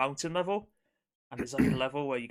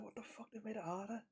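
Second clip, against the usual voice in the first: much softer, higher, baffled mutter; hushed disbelief; 9 semitones higher, 12 dB quieter than usual. Retold or imitated. Player realising the remaster is harder, hushed high disbelief. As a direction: mutter it, stunned by the difficulty.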